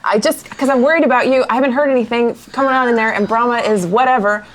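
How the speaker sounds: ordinary talk, nothing marked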